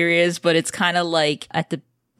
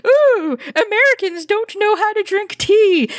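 high, loud sneering impression of a snooty Brit; mock British snob; 14 semitones higher, 4 dB louder than usual. Mocking British people on Twitter in a high, loud sneer. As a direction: lampoon a tea snob with a posh sniff. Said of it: "British people on Twitter being like".

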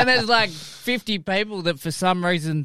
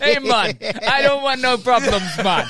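bad Irish-Jamaican accent; 4 semitones higher than usual. Mimicking the rival backing down, hammed-up Irish-Jamaican accent. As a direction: put on a botched Irish accent that turns Jamaican. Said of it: "the Spearwood Irish".

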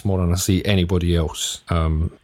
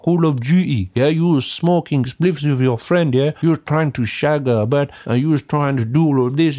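mock crypto bro; 8 semitones higher than usual. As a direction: talk like a cocky crypto hustler hyping easy money. Mocking a crypto hype bro.

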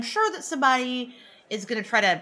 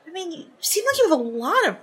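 high, sweet, coaxing plea; butter him up, sugary and high; cute flirty voice; 9 semitones higher, faster than usual. Acted. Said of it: "put on, like, kinda cute".

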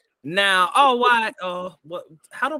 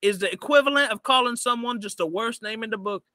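higher New Orleans lecture, running on; New Orleans accent; 4 semitones higher than usual. Acted. [name] explaining the insult Earth, scolding New Orleans accent. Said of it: "a New Orleans accent".

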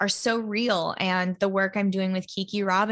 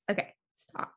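softer whispered self-command; stopping herself crying; 9 dB quieter, faster than usual. Real, not acted. Holding back tears over her dream car, whispered to herself. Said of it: "Oh my God, I'm gonna cry".

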